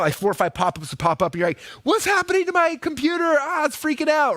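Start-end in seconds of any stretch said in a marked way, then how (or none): 1.8-4.4 high, hammed-up panicked user; mock panic. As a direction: fake alarm at a crashing computer. User panics at pop-ups.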